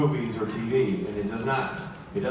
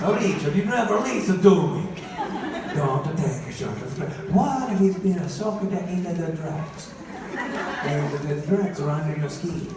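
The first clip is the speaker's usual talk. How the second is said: hammed-up Italian mother giving advice; exasperated mock-Italian mama; bad Italian accent; 3 semitones higher than usual. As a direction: scold and lecture in a comic Italian accent. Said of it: "My mom always talks in a bad Italian accent".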